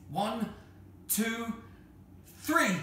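high count climbing to a loud three; counting shout. Acted. Children on the tower count to yell, rising shout.

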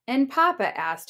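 ordinary delivery, nothing marked

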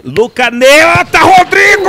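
rising to a shouted name; excited commentary. Real, not acted. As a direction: call the save at full voice. Live play-by-play peak.

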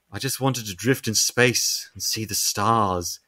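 soft, low, slow mournful voice; wistful lament. Retold or imitated. Dying starfish villain laments to itself, soft and mournful. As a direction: sigh it like a lonely creature. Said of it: "Starro's just like".

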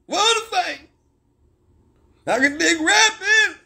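hammed-up high mocking voice, drawn out. Mocking someone in a put-on voice.

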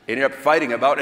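ordinary talk, nothing marked

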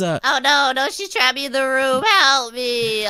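hammed-up distress call; panicked. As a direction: wail it out as if trapped. Trapped, calls for help.

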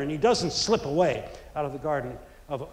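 ordinary talk

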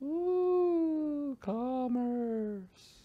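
high, soft, eerie drawn-out ooh; spooky voice. Acted. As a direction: say it like a mocking ghost. Spooky take on word.

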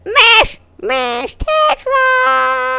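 high, loud cry with a long tail; hammed-up scream. Game character calls out to Tetra, drawn-out high scream. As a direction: shriek the name and stretch it out.

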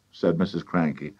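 plain reading voice

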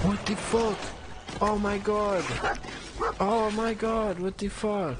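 high, loud startled shrieks; shocked yelling. Real, not acted. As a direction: freak out in loud disbelief. Sudden shock at game event.

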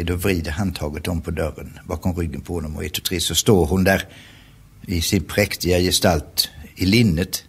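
usual speaking voice

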